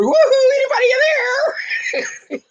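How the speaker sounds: high, playful holler; calling out. Retold or imitated. Host calling to a frozen co-host, high playful holler. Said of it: "I'm over here going".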